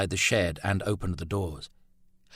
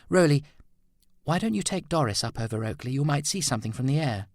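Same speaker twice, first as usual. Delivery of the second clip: higher female voice, hopeful urging; woman urging him to fly over Oakley, lightly higher and hopeful; sculptress voice; 7 semitones higher, faster than usual. Acted. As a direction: lift it into the woman's voice, eager. Female character voice.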